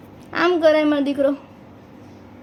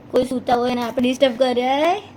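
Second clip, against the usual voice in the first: normal talking voice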